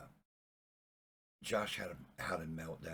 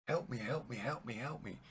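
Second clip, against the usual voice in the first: raised, slightly higher cry for help; mild mock scream; 5 semitones higher, 3 dB louder than usual. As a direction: call up urgently, without a full scream. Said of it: "He's screaming up at them".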